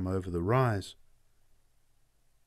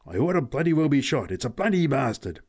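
louder, higher self-cursing; cursing himself; 5 semitones higher, 4 dB louder than usual. Acted. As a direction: berate yourself for being late. Pat cursing himself, late.

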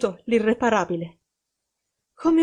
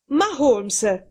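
higher-pitched objection; taken aback, leaning on the first word; startled protest; 4 semitones higher, 3 dB louder than usual. A narrator's reading. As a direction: protest in mild dismay. Friend protests at hiding plan.

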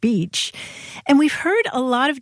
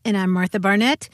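normal talking voice

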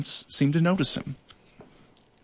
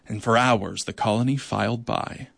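ordinary delivery, nothing marked

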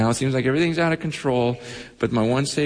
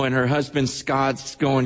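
normal talking voice

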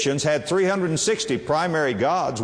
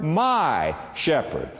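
"my" pressed, slow; slow, personal weight on "my"; stress on my; much slower than usual. Shown as a demonstration. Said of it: "that little word".

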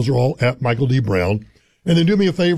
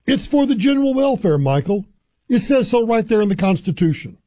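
higher-pitched mock pronouncement; triumphant trump-card quote; 6 semitones higher than usual. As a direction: say it like playing a winning card, pitched up. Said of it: "like they're laying down a trump card".

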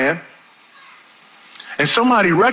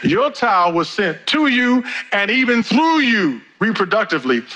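"to you" and "through you" punched higher; emphatic repetition; 5 semitones higher than usual. Real, not acted. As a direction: drive home "to" and "through" with raised pitch. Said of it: "I'm gonna say that again 'cause that might be very important".

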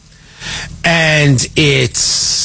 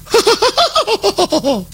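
high, falling mock laugh of Tucker; imitating Tucker's laugh; laugh impression; 14 semitones higher than usual. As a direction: cackle up high and let it tumble. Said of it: "that was my laugh impression".

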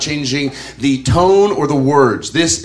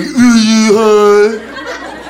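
extremely drawn-out, higher mock echo; mocking comeback; 5 semitones higher, 4 dB louder, much slower than usual. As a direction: parrot it back, stretched even further. His mocking comeback to friends.

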